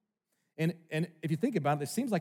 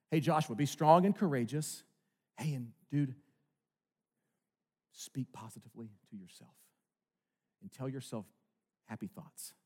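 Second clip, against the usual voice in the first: mock casual God, fading soft; 5 semitones lower, 4 dB quieter than usual. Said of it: "He doesn't say".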